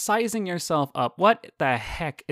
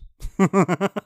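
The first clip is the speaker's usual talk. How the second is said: laugh at own pun; 4 semitones higher, 7 dB louder than usual. Real, not acted. Joking about Tom Cruise pun.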